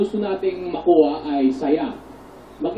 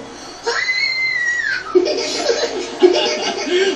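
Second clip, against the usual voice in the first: very high laughing squeal; highest squeal. Real, not acted. Asked for the highest note, laughing high squeal. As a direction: reach for the top of the voice and laugh. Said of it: "Anong pinakamataas sa boses mo?".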